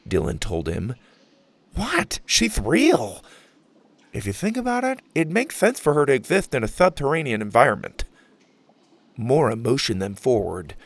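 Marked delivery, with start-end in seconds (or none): 1.7-8.0 put-on eager nerdy boy voice. Max defends his theory.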